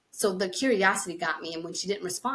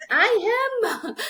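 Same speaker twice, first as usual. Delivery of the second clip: high-pitched laughing retort; exaggerated defensive comeback; mock indignant protest; 6 dB louder than usual. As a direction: protest loudly like a harried kid. Young self protesting to uncles.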